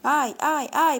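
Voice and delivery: fleeing grandfather, panicked cries; crying out ай. Acted. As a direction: yelp while running from the lashes. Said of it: "покрикивал только дед".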